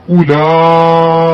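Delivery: उलाइका very slow, लाम drawn long; slow stretched recitation. Shown as a demonstration. Teacher showing how उलाइका is recited, ला drawn out. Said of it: "किस तरह पढ़ेंगे?".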